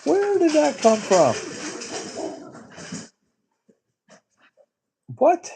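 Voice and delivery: can't believe what he just read; real high pitched disbelief; shocked. Real, not acted. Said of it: "I'm very shocked".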